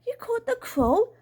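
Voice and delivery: high, rising echo of disbelief; astonished question, pitched right up; incredulous. Acted. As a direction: repeat it back in high-pitched amazement. Incredulous echo of Dad's announcement.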